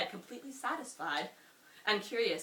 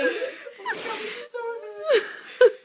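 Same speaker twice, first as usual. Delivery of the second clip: laughing; 10 dB louder than usual. Real, not acted. Reader laughing at own story.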